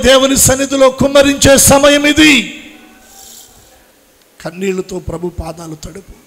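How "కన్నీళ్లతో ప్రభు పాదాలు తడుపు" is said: softer, hushed prayerful plea. Acted. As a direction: lay it down gently, almost to yourself. Preacher repeats plea softly then loudly. (మృదువుగా, నెమ్మదిగా చేసే వేడుకోలు)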